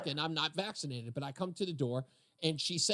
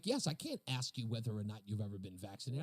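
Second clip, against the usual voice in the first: retelling a climb-down, low and much softer; backing down; 8 semitones lower, 8 dB quieter than usual. Retold or imitated. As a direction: drop low and soft as if conceding the point. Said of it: "she immediately backed down".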